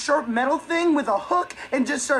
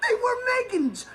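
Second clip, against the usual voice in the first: high-pitched indignant outburst; indignant, pitched-up reproach; exasperated scolding; 7 semitones higher than usual. Acted. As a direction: protest in exasperated disbelief. Scolding over the eaten fruit popple.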